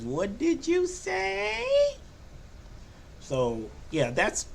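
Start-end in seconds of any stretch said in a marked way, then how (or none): 0.0-1.6 put-on very high, slow child's question; granddaughter's voice. Grandfather imitating his granddaughter asking, very high and slow. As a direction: stretch it out like a little girl asking. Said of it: "she goes back and says".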